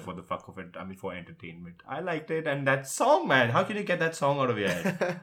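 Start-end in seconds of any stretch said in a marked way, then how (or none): 1.9-5.2 high, loud and laughing; laughing excitedly. Real, not acted. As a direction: gush about the song through a laugh. Raving about the movie's song.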